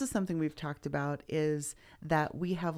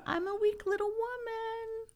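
high, slow simpering voice; mock weak little woman. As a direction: play a helpless little woman. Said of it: "we're just declaring".

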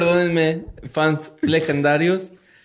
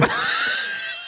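chillido agudo de risa; 8 dB quieter than usual. Real, not acted. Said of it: "Ya, por favor".

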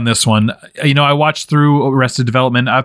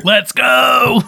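excited cheer; 11 semitones higher than usual. Real, not acted. Cheering on a pick.

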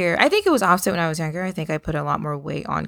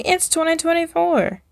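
much higher, imitating excited people; mock New Year excitement; 12 semitones higher than usual. Mimicking people announcing the year, in mock excitement. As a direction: parody people hyped about the new year. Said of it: "when people say".

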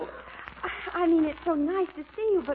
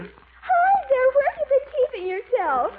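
high, lively call to a friend; flirty hello thrown across the room; gushing greeting; 7 semitones higher, 4 dB louder than usual.

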